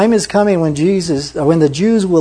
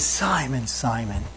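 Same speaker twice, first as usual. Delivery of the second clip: soft, sorrowful plea; hushed, heartbroken call; 3 semitones lower, 9 dB quieter, slower than usual. Retold or imitated. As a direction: say his name softly, grieving. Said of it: "his heart's being broken".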